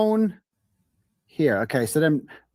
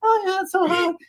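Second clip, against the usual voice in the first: much higher, hammed-up falsetto moaning; mock woman's moans; 12 semitones higher than usual. Voicing the doll as the woman moaning to Brian, high hammed-up falsetto.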